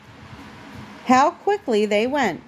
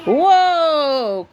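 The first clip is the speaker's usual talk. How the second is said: a sudden loud "Whoa!" of alarm; startled cry; 5 semitones higher, 4 dB louder than usual. A narrator's reading. Santa thrown about as the sleigh veers, crying out. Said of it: "cried Santa".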